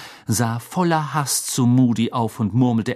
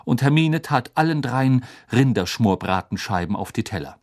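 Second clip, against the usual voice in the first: plain reading voice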